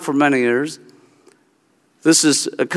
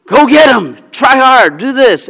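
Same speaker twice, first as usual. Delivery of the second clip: higher, louder pep talk; a hint of a sports coach; 6 semitones higher, 3 dB louder than usual. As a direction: cheer your kid on like a coach. Parent acting as a coach.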